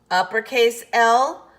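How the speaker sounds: ordinary delivery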